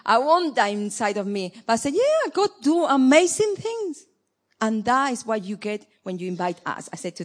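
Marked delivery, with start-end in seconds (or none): 1.9-4.1 sarcastic. Retold or imitated. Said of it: "I was a little bit sarcastic".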